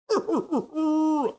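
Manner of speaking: a sudden high laugh at the chat; high burst of laughter. Real, not acted. Host reading a funny chat message, high burst of laughter. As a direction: break into high-pitched laughter.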